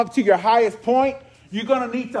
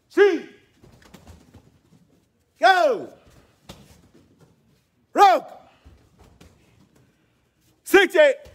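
sharp high count shouts; shouted count; 6 semitones higher, 7 dB louder, much slower than usual. Real, not acted. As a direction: bark out each count loud and high. Karate teacher shouting count.

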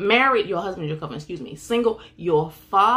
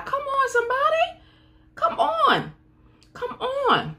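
excited call-out. Real, not acted. Preacher rousing the congregation.

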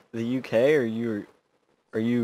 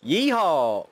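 a loud cowboy yeehaw; mock cowboy holler; yeehaw shout; 5 semitones higher, 4 dB louder than usual. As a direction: whoop it out like a rodeo rider. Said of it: "Say, "Yeehaw, you can ride me".

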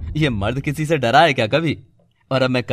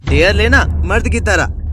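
loud, higher, slow taunt; taunting challenge; 6 semitones higher, 5 dB louder than usual. Acted. College friend goading Shikhar to take the dare, loud taunt. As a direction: goad him like a man.

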